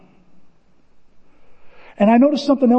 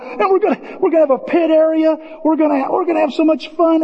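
excited pitch; 7 semitones higher than usual. Retold or imitated. Excitedly pitching the race event.

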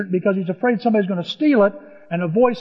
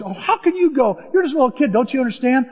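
higher, incredulous king; doubtful king; 6 semitones higher, 4 dB louder, faster than usual. Acted. King Saul doubting young David can fight, higher and incredulous. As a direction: scoff at the boy's offer.

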